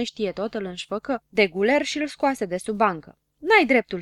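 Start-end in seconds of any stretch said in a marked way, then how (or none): 3.4-4.0 louder, higher protest; indignant yell. A narrator's reading. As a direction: yell as you're dragged out. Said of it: "striga habar n-am".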